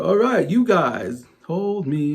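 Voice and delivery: mock stage call, sliding into the sung line; bandleader cueing crowd. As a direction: imitate a frontman starting a singalong. Acting bandleader cueing crowd singalong.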